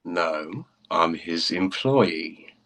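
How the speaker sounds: put-on slow, stiff reply in a British accent. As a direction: hold your pride, slowly, British accent. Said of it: "That was a fun accent for it".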